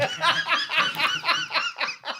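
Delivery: high wheezy laugh. Real, not acted. Cracking up over the Dave Shearer story, laughing. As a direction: burst into a long, high laugh.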